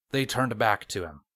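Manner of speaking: plain reading voice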